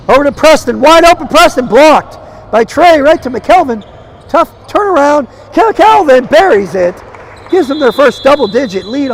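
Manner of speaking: rising to a loud, high play-by-play call; excited call. Real, not acted. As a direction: call the run as it builds to the bucket. Fast break ends in a bucket.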